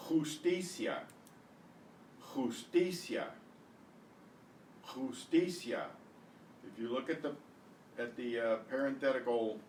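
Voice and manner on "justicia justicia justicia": "Justicia" as khoos-TEE-syah; Spanish pronunciation. Shown as a demonstration. Teacher repeating it for students, "Justicia" as khoos-TEE-syah. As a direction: say "Justicia" with throaty J, stress on TI. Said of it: "indicates where the stress is".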